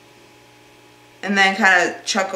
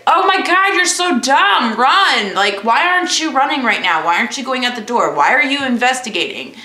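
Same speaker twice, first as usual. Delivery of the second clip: high, loud exasperated yelling at a horror movie; frustrated viewer shouting at a horror film heroine; 6 semitones higher, 4 dB louder than usual. Retold or imitated. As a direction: scold the character on screen like they can hear you. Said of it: "or I'm just like".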